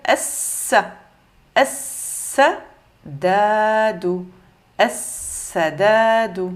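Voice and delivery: slow "Es-sadadu" with no L and a long doubled S; lam muet, shadda. Shown as a demonstration. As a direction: sound out "Es-sadadu", hissing the S long. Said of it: "on l'oublie". (« Es-sadadu », S doublé, sans L)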